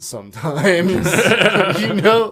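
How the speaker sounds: giggling through the words; laughing. Real, not acted. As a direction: laugh as you say it. Laughing at own remark.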